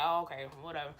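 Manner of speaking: a deflated brush-off; backing down. Retold or imitated. Boyfriend backing down over knives, deflated low. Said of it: "he was like".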